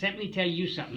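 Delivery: telling off a lieutenant in retold anger; mad retort. Seaman snaps back at the lieutenant with mock anger. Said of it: "that made me mad".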